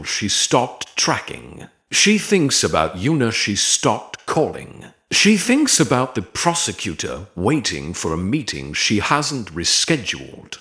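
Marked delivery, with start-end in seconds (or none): none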